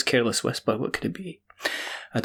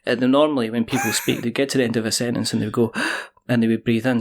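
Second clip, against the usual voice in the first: usual speaking voice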